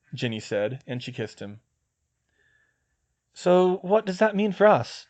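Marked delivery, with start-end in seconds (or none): 3.3-5.1 put-on higher, louder, uneasy boy. Neville unsure where he stands, asking uneasily. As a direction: ask it hesitantly, unsure. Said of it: "Neville said uneasily".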